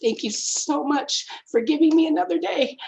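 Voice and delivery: choked-up thanks for the day; almost tearful thanks; emotional gratitude. Retold or imitated. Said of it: "I'm gonna get all emotional".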